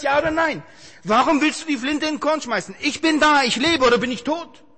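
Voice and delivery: high, loud, commanding divine voice; God's booming rebuke. Acted. God scolding his despairing servant, booming and loud. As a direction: thunder it out like the Lord scolding his child.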